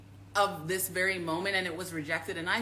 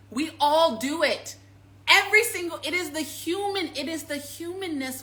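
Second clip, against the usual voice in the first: louder and higher; emphatic; 7 semitones higher, 5 dB louder than usual. Real, not acted. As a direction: rise up, insistent. Emphatic rise.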